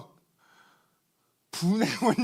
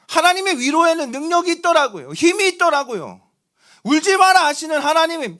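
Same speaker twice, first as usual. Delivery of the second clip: higher, louder proclamation; fervent preaching; 7 semitones higher, 8 dB louder than usual. Acted. Pastor proclaiming the power of Jesus' comfort, fervent. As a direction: build the declaration with conviction.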